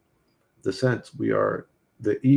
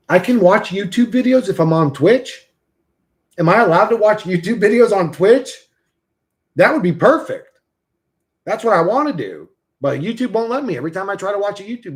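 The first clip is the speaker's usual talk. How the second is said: excited; 9 semitones higher, 7 dB louder than usual. Real, not acted. Excited about watching YouTube on Twitch.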